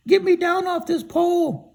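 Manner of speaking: high, loud cartoon scarecrow; storyteller voicing a high silly scarecrow. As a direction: pitch way up and call out as the scarecrow. Scarecrow stuck on a pole.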